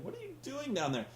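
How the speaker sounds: soft, fast, dopey imitation; quiet mock quote. Host repeats the wrestler's line quietly, fast and soft. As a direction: mutter the dumb line back quickly. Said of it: "man going".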